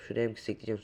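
ordinary talk, nothing marked

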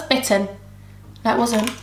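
normal talking voice

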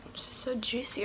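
hushed whisper. Real, not acted. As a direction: breathe it out as a whisper. Rubbing mask on face.

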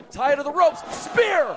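high, loud excited call; excited shout. Real, not acted. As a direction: belt out the move. Play-by-play peak, spear hit.

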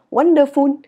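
"wonderful" high and loud, no falling end; wrong Vietnamese intonation. Shown as a demonstration. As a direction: tone out "wonderful" syllable by syllable. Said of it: "Đừng đọc là". (đọc "wonderful" kiểu Việt, không xuống)

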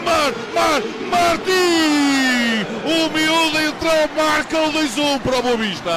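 high, loud goal scream; fast dramatic football commentary. Real, not acted. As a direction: roar the goal call, stretching it. Second goal, commentator erupts.

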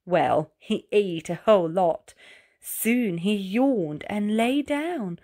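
ordinary delivery

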